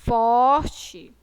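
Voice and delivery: heavy stress on FOR in forte; strong stressed syllable. Shown as a demonstration. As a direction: punch out forte for the class. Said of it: "a sílaba mais".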